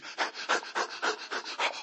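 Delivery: out-of-breath gasps, imitating someone running; mimed frantic panting; panicked gasping.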